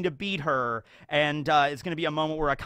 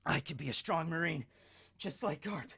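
quiet resolve; 10 dB quieter than usual. Retold or imitated. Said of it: "It's gonna be like".

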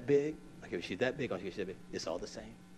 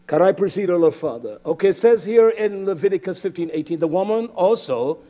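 loud, raised scripture declaiming; forceful preaching; 6 semitones higher, 14 dB louder than usual. Real, not acted. Preacher reading Leviticus on copulation to the congregation, forceful preaching. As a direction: proclaim the verse to the congregation.

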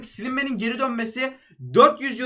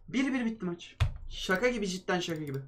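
much softer, flat disbelief; dejected; 3 semitones lower, 10 dB quieter than usual. Real, not acted. Gamer telling viewers the draw result, softly dejected. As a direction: sag into it after the final whistle.

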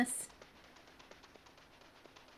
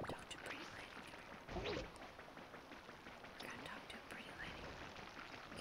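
whispering; 20 dB quieter than usual. Acted. Coaxing in-game characters quietly.

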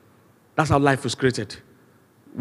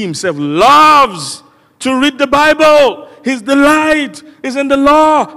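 high, loud, stretched sermon delivery; full-force preaching; 9 semitones higher, 7 dB louder than usual. Real, not acted. Preacher scolding online delights, pointing to scripture, full-force preaching. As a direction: drive it home to the congregation, voice raised.

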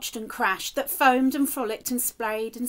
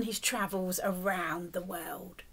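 whisper; 5 semitones lower, 7 dB quieter than usual. Real, not acted. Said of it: "reader whispers question to child".

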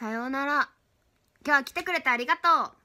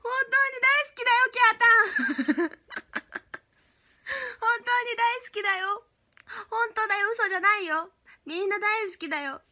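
much higher, sugary idol voice; cutesy idol voice; 8 semitones higher than usual. Acted. Idol streamer thanking fans for gifts, high and sugary. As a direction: gush like an idol doting on fans.

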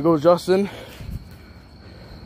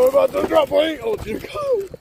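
excited; 9 semitones higher than usual. Real, not acted. About to drop down hill.

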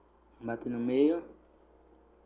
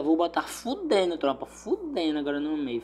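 usual speaking voice

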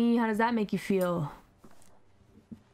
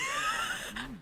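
high shrieking laugh; 8 dB quieter than usual. Real, not acted. Cackling at the chaos.

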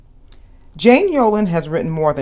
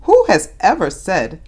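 ordinary delivery, nothing marked

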